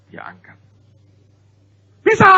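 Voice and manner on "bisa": very high, loud cry of success; triumphant shout. Retold or imitated. Grown child lifting at last, loud triumphant cry. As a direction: shout it like a kid who finally did it.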